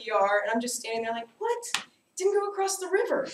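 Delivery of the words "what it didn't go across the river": put-on high, fast, puzzled child; high confused little-girl voice; baffled kid voice. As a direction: play the clueless little kid. Confused kid after hitting dad's friend.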